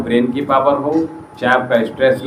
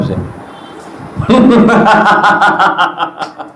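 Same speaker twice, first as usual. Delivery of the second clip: high, ringing open-mouthed laugh; demo laugh; 7 semitones higher, 3 dB louder than usual. Shown as a demonstration. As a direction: laugh loudly to show the class how. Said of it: "हंस के दिखाओ". (ज़ोरदार ठहाका)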